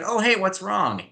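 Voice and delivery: high, breezy and unbothered, same as the insult; mock-cheery concern with no change in tone; bright chirpy what's wrong, as if nothing happened. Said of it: "in the exact same inflection and tone".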